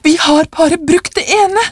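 high, drawn-out excitement; excited wife. A narrator's reading. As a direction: spell it out eagerly, high and slow. Excited wife explains remaining wishes.

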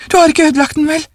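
high, urgent question; anxious wife. A narrator's reading. Agitated wife anxiously questions husband.

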